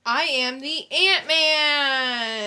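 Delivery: hammed-up announcement. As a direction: boom it out like a superhero. Announcing the movie title.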